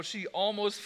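normal talking voice

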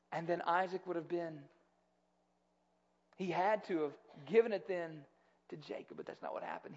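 usual speaking voice

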